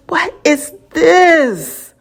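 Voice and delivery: slow showy podcast intro question; slow dramatic ask. Shown as a demonstration. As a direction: drag "What is this?" out slowly with a rise in pitch. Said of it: "how do I say it".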